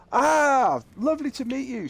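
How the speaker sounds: high, gushing mock welcome; effusive greeting. Manager shaking the wife's hand, imitated effusive greeting. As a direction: imitate an over-delighted manager saying hello.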